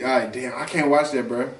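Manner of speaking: ordinary talk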